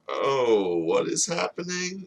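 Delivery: high-pitched bewildered question; very confused. Acted. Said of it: "He's very confused".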